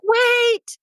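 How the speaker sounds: very high, stretched cry to stop; acted high-pitched plea; urgent call. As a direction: call after someone walking away. Calling after her departing younger self.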